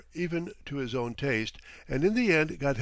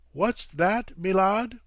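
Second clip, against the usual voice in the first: higher, louder Cockney query; Cockney captain; 7 semitones higher, 6 dB louder, slower than usual. A narrator's reading. Captain turning to his guest, Cockney. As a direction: turn back to him, Cockney.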